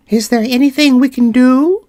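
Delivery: high little mouse voice, eager to help; a mouse offering thanks; polite big mouse. Acted.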